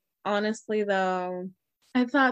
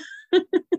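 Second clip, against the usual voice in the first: high giggle; 11 semitones higher, 5 dB louder than usual. Real, not acted. Loud high laugh.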